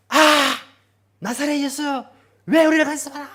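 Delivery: shouting high and loud. Shown as a demonstration. Possessed man shouting at Jesus, loud yell. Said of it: "큰 소리로".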